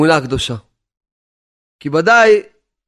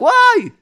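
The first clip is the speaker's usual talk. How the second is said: short, high-pitched wail; wordless cry; slower than usual. Acted. Poor man cries to God in pain, high wail. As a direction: cry out sharply high, acting the part. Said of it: "רק יצעק".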